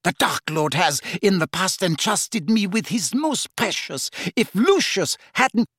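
high, rising self-justification; heated, high-pitched villainess; Bellatrix, defensive. Acted. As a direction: protest with mounting pitch and urgency.

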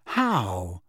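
low, puzzled question; perplexed. A narrator's reading. Harry asking Lupin to explain, perplexed. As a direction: ask it in mild puzzlement. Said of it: "Harry asked perplexedly".

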